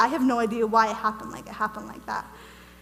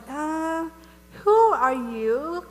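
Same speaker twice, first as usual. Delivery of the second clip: slow, stretched-out question; hesitant "uh", then a drawn-out query; bewildered questioning; 4 semitones higher, 3 dB louder, much slower than usual. Retold or imitated. Retelling her question to the giant angel, bewildered.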